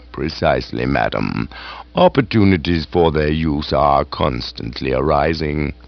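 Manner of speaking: calm, unruffled butler replies; deferential butler. Acted. Jeeves answering the aunt's questioning.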